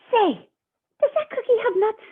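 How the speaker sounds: high, loud pigeon voice asking; eager pigeon. Acted.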